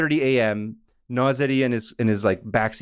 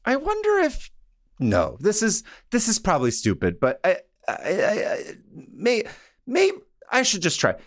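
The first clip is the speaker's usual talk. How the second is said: hesitant, halting thinking aloud, pitched up; mulling it over; 9 semitones higher than usual. Retold or imitated. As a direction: stammer towards a decision, pausing between attempts. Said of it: "he's mulling it over".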